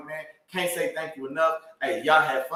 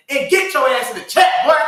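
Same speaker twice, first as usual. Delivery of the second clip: higher, louder rallying call; 8 semitones higher, 10 dB louder than usual. Real, not acted. Host rallies viewers into the live chat, loud. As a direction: pump the audience into the chat.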